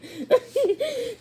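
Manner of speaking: bright high laugh; high giggle. A narrator's reading. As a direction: giggle at the tickle. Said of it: "Pippa giggled".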